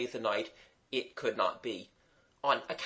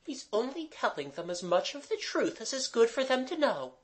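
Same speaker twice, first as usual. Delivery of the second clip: put-on high-pitched girl's voice; defending girl; 10 semitones higher than usual. A narrator's reading. Mabel defending Gerald to Jimmy, earnest. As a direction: explain in a much higher, girlish voice.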